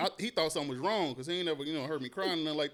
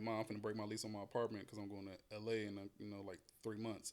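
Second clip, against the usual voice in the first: sheepish quiet ask; 6 semitones lower, 10 dB quieter than usual. Retold or imitated. Asking mom, sheepish quiet.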